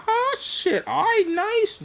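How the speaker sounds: high-pitched mock delight; imitating a delighted windfall; retold pleased surprise. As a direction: squeak it high, like someone handed unexpected cash. Acts out delight at cash.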